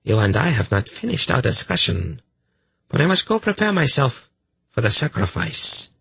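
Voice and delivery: high, imperious priestess voice. Acted. Priestess leaving to prepare for the sacrifice, high and formal.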